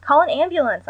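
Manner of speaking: loud high command; ordering. A narrator's reading. Daughter ordering her mother to call help, loud command. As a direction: bark the order down the phone. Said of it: "I ordered".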